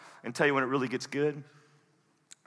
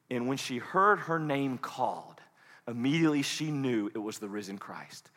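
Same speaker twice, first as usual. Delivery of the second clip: ordinary delivery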